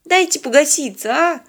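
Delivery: high-pitched, earnest appeal; pleading. A narrator's reading. Polina explaining their engine trouble to the old man, pleading. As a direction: keep imploring him. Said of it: "взмолилась Полина".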